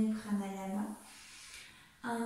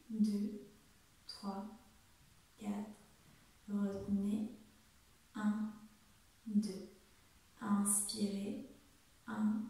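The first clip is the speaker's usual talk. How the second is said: guided breath count; much slower than usual. Acted. Counts the breathing exercise aloud.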